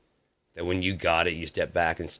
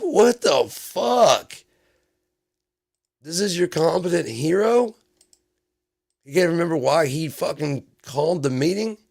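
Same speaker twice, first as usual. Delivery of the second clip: outraged disbelief; 9 semitones higher, 6 dB louder than usual. Real, not acted. Disbelieving the president forgot the order, loud and outraged. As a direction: bellow your disbelief.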